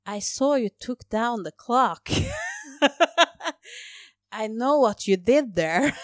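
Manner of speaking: put-on loud, slow, drunken English; hammed-up drunk, stretching the words; tipsy re-enactment. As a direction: announce it slowly and loudly, as if tipsy. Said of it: "man er jo litt full da".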